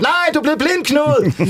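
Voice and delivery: high squeaky mock voice of a keen charity; mock eager advocate. Blind society eagerly claiming Knud as mascot, high mock voice. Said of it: "kommer blindesamfundet og vil have mig som maskot".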